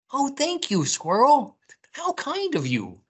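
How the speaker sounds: very high, gushing rabbit; high-pitched storybook gratitude; grateful rabbit. A narrator's reading. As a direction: thank a friend as a little rabbit. Rabbit thanks Squirrel.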